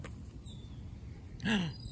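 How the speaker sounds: breathy surprised gasp; hush-gasp of surprise; whispered gasp. Real, not acted. Seagull arrives.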